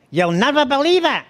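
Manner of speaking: high, nasal impression of the wife; mocking high nasal whine. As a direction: talk right through your nose, high-pitched. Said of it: "My wife talks through her nose".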